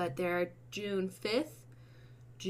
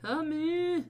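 mock plea; 6 semitones higher, 3 dB louder, much slower than usual. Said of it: "without having to be like".

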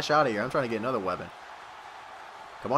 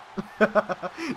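higher-pitched giggle; amused laugh; 5 semitones higher than usual. Real, not acted. Player laughing at confusion over a table, amused. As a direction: laugh at yourself.

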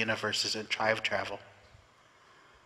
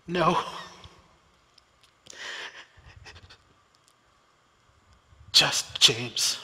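croaked; 5 semitones higher, much slower than usual. Acted. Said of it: "he croaked".

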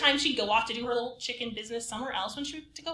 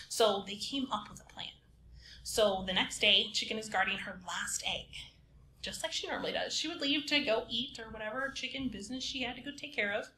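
ordinary talk, nothing marked

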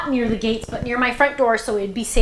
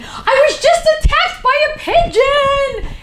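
much higher, louder, slowed panicked cry; freaking out; 6 dB louder, slower than usual. Retold or imitated. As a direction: imitate a friend in a pigeon panic. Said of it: "she was freaking out".

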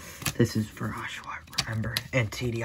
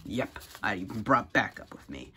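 ordinary talk, nothing marked

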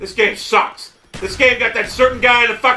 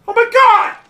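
exasperated shout; 13 semitones higher than usual. Real, not acted. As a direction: yell it in disbelief. Outburst at teammate color.

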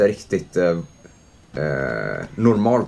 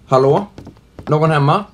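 calling out; 3 semitones higher, 4 dB louder than usual. Real, not acted. Calling into empty house.

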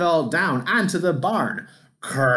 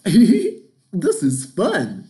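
slow, high, loud laugh through the words; 6 semitones higher, 6 dB louder, much slower than usual. Acted. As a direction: giggle with delight while saying it. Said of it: "he laughed".

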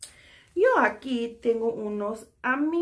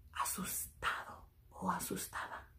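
scared whisper; 15 dB quieter than usual. Shown as a demonstration. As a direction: breathe the words as if frightened. Shows a scared face.

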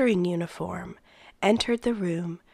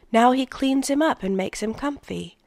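eager; 5 semitones higher, 3 dB louder than usual. A narrator's reading. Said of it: "Jonas told him".

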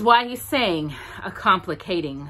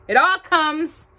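higher, louder, slower bear voice; high Pooh voice; 10 semitones higher, 6 dB louder than usual. Acted. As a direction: Pooh crying out from the bush. Pooh in gorse bush.

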